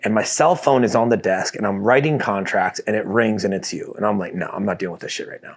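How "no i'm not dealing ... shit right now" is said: dismissive mutter. Retold or imitated. Retold ignoring wife's phone call.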